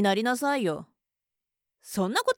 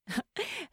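soft mocking laugh; 6 semitones higher, 11 dB quieter than usual.

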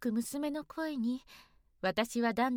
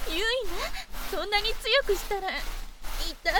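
high, loud flustered protest; protest, flustered and aroused; 7 semitones higher, 4 dB louder than usual. Acted. Mother protests rough washing.

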